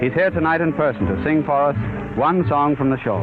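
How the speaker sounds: ordinary talk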